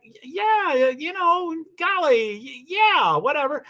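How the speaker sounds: high, stammering aw-shucks yes; flustered acceptance. Retold or imitated. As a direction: stutter out a bashful yes to the offer. Acting flustered accepting more food.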